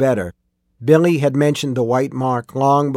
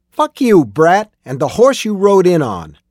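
high, loud angry defiance; defiant cursing; 5 semitones higher, 5 dB louder than usual. A narrator's reading. Cornered miner cursing the young gunslinger, angry defiance. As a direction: spit back an insult, voice raised.

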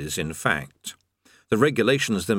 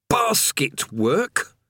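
drawn-out higher disdain; slow bitter chained man; 6 semitones higher, much slower than usual. A narrator's reading. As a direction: sour weary prisoner.

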